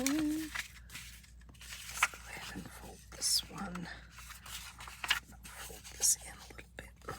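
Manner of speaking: breathy whisper while crafting. Real, not acted.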